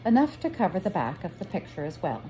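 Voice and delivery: ordinary delivery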